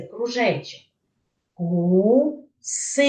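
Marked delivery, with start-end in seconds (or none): 1.5-3.1 ГУСИ with a long, drawn-out у; slow гу-си, first vowel held; sounding out. Shown as a demonstration. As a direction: stretch гуси so each sound is heard. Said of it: "який ви чуєте звук".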